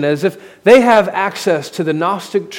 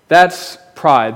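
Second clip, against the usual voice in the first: normal talking voice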